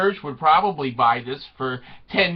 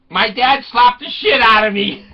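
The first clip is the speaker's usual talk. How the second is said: loud, mock-indignant burst; comic outburst; 3 semitones higher, 7 dB louder than usual. Daughter telling of her angry dad, loud comic outburst. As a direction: blurt it out loudly, half laughing. Voicing Emma's shocked complaint.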